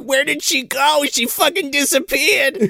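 high, bewildered mock alarm; mock panic. Kids searching for the woman, high mock panic.

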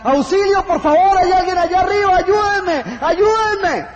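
high, loud, terrified cries for help; desperate scream for help. Acted. Man dangling from a cliff screams for help, terrified. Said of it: "comienza a gritar".

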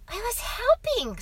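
put-on high child voice; protesting boy. A narrator's reading. Boy defends himself to father, protesting. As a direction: plead your case as a small boy.

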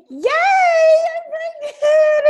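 high, loud, stretched-out cheer; hammed-up singsong good news; mock celebration. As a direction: draw it out in an awkward squeal. Mock celebrating to silent husband.

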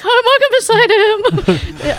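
high squealing laugh through the words; giddy fangirl. Retold or imitated. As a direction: gush like a starstruck fan. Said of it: "I was, like, super excited".

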